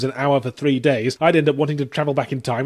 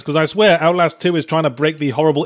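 ordinary delivery

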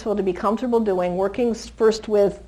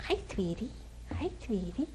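much softer hi sweetie; soft greeting; 2 semitones lower, 16 dB quieter than usual. Real, not acted. Trainer greeting the little puppy: soft gentle coo.

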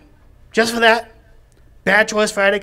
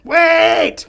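shouting; 7 semitones higher, 4 dB louder than usual. Real, not acted. Interrupting the announcements.

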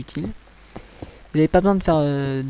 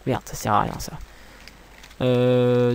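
usual speaking voice

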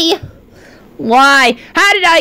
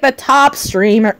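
usual speaking voice

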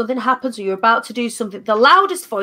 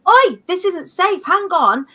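alarmed inner voice; 7 semitones higher, slower than usual. Retold or imitated. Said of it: "the loudest voice is".

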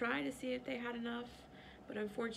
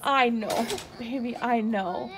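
raised I know over the crying; louder consoling; loud soothing; 12 dB louder than usual. Real, not acted. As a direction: comfort her loud enough to be heard. Comforting a crying child over noise.

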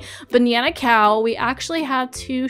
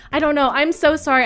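ordinary talk, nothing marked

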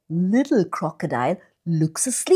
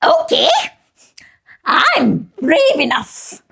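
brave monkey voice; 8 semitones higher than usual. Acted. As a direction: puff up like a proud monkey.

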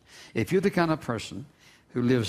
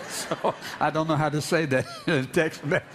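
laughing; 4 semitones higher than usual. Real, not acted. Laughs at his own texting.

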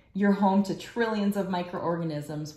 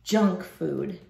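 ordinary talk, nothing marked

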